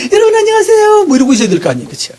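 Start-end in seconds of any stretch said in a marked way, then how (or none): 0.1-1.0 much higher, bright put-on greeting voice; fake cheerful greeting. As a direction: play the smiling host hiding his misery. Mock cheerful public greeting. (속으로 괴로운 목사가 교인들에게 억지로 밝게 인사하듯, 높은 톤으로)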